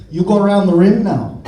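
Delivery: loud mock Icelandic accent; playing an Icelander in a strong voice; fake Icelandic accent. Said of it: "in my best fake I-, Icelandic accent".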